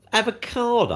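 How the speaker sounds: high mock-shocked avocado; jokey disbelief at a posh food; incredulous. Real, not acted. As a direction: sound amazed he eats that. Mocking nephew's posh taste.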